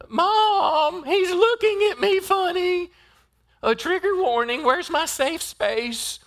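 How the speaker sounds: high, slow whiny complaining; whiny tattling little sibling. Retold or imitated. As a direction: whine like a tattling kid tattling to mom. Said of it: "if you can see this college kid".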